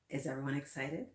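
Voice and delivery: plain reading voice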